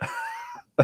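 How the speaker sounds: high burst of laughter. Real, not acted. High laugh after the story.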